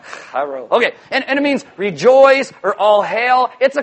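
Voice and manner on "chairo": "Chairo" pitched low, falling; foreign-word pronunciation. Shown as a demonstration. Preacher excusing his attempt at original language, low-pitched pronunciation.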